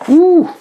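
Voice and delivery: high, loud "ooh"; impressed whoop. Real, not acted. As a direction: let out a high admiring whoop. Reacts to the collection booklet.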